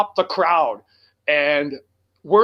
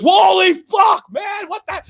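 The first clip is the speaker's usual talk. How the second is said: radio guy's amazed yell; 14 semitones higher, 4 dB louder, slower than usual. Retold or imitated. Imitating his radio guy from New Jersey, high amazed shout. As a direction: imitate someone blown away.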